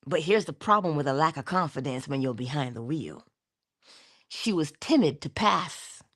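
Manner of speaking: normal talking voice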